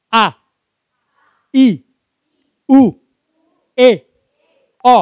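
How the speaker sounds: high, loud, separate vowel calls; big loud vowels. Shown as a demonstration. Trainer demonstrating articulation drill, loud exaggerated vowels. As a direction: belt out each vowel with a pause between. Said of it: "ngucapin huruf vokal secara, eh, besar".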